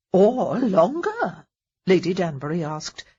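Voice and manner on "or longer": slow, sly gleeful question; touch of glee. A narrator's reading. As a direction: savour the delay. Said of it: "with perhaps a touch of glee".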